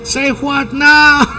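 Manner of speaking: mock interviewer. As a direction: play the confused call-center interviewer. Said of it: "va a decir". (entrevistador confundido, en broma)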